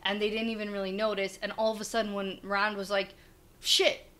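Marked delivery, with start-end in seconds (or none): 3.6-4.1 panicked. Retold or imitated. Rand panicking in retold scene.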